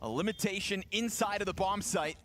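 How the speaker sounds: energetic play-by-play call; esports caster. Acted. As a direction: call the retake with rising hype. Live play-by-play casting.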